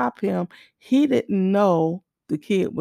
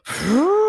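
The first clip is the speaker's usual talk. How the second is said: rising imitated engine roar; loud car vroom; 9 semitones higher than usual. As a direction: mimic a car zooming past, pitch swooping up. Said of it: "we hear this loud".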